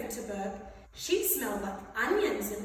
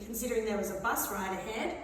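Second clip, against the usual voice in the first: ordinary delivery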